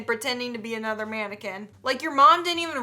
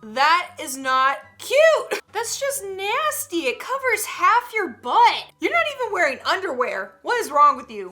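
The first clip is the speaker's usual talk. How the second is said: disgusted rant; 6 semitones higher, 5 dB louder than usual. Real, not acted. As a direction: scold the whole decade. Ranting about low-rise jeans.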